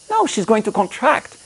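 ordinary talk

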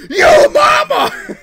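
rising into a slow, very high, loud shriek; mock yo mama yell. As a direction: stretch the last yo mama into a squeal. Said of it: "somebody goes".

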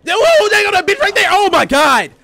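very high, very loud shriek; startled scream. Real, not acted. Player startled by an enemy, panicked scream. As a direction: scream it out in shock. Jump scare in game.

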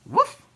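loud, high, short woof; dog bark. A narrator's reading. Ebb fetching help, loud high bark. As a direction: yap urgently like a dog. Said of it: "barked Ebb".

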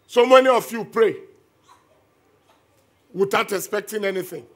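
loud, fervent preacher exhorting the crowd; full-force preaching. Acted. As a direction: preach it hard to the congregation.

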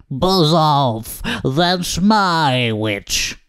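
yowling, growling monster, drawn out; terrible beast voice. A narrator's reading. Beast warning the dragon off its witch, growling. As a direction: growl it slowly like a horrible beast. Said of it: "was a yowl and a growl".

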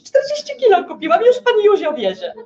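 wyżej, głośniej, zmienionym głosem; matka Jowiszowa. Retold or imitated. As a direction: chwal się hurtem jak matka. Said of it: "to jest Słońce Jowisz". (matka ogłasza zakup czterdziestu kilo, wyżej i głośno)

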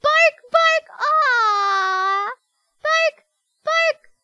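high, loud yapping bark; barking dog. Acted. Gamer reading the dog's barks on the phone, yapping. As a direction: yap like a small excited dog.

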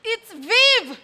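high, loud correction; exasperated, high-pitched yell; irritated shout back. Acted. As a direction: fling the name back over the shoulder. Shouts correction while walking away.

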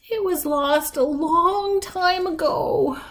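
put-on slow, high old-woman voice; wistful granny. A narrator's reading. Granny recalling a long-ago loss, slow and wistful. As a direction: reminisce slowly from far back. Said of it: "said Granny".

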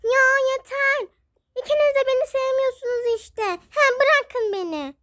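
put-on child pleading for the fighting to stop; fed-up cry. (tiz ve yüksek sesle, bıkkınca)

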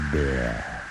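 ordinary delivery, nothing marked

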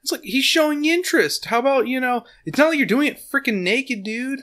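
ranting high in disbelief; indignant rant. Real, not acted. Mock outrage defending Gary.

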